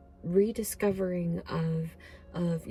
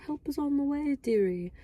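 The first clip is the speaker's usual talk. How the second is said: much higher, lightly voiced granny nanny; Mrs. Doubtfire; 7 semitones higher than usual. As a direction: chirp it like a sweet old nanny. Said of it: "I kept seeing Missus Doubtfire".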